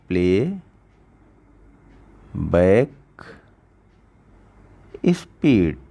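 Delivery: "Playback ... speed" drawn out with long pauses; word-by-word emphasis. Shown as a demonstration. Teacher points viewers to a setting, word-by-word emphasis. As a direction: break "playback" and "speed" far apart, deliberately.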